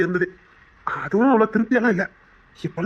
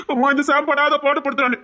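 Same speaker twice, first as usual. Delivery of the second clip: higher-pitched mock complaint to mother; mimicked tattling daughter; 7 semitones higher than usual. Suitor imitating her complaint to her mother, higher mock tattling. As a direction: play the girl telling on him, pitched up. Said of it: "உங்க அம்மா கிட்ட போய் சொல்லணும்".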